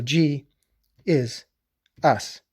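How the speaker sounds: each word set apart with long pauses; spaced out, word by word; slow G is us. Shown as a demonstration. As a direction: say So G, is, us one word at a time. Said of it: "You cannot say "G is us" fast".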